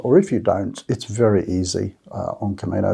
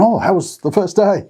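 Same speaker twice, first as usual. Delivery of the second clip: a brighter, higher cheerful question; lightly voiced friendly couple; 9 semitones higher, 3 dB louder than usual. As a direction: mimic a chatty fellow pilgrim asking kindly. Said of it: "they were going".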